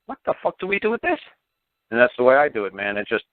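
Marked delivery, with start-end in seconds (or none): 0.1-1.2 baffled agent. Retold or imitated. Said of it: "makes them like".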